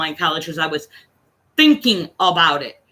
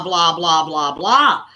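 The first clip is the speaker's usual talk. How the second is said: dismissive blah blah; 4 semitones lower, much slower than usual. Retold or imitated. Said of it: "whose side do you think he was on".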